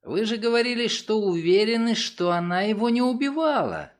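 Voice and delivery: high, urgent, anxious protest; agitated. A narrator's reading. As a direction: object in alarm, pitch held well up. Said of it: "взволновался Карл Хейт". (взволнованно, с тревогой в голосе)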